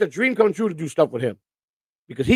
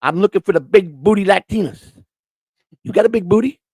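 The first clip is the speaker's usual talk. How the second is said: imitating his bit; 4 semitones higher, 3 dB louder than usual.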